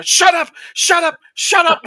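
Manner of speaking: loud, high-pitched snapped screams; screaming. Retold or imitated. Writer silencing his wife while taking notes, screaming. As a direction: yell it three times, each a burst. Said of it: "screaming at people to shut up".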